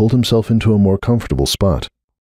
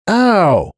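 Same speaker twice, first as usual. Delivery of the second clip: much higher, sharp yelp of pain; 12 semitones higher than usual. Acted. Hoops, punched on the nose by his son, yelps in pain. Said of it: "he yelped".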